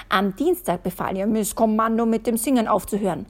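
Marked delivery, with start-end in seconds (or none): none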